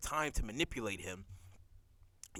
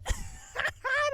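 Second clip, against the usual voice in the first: high laugh. Real, not acted. Laughing after the whoop.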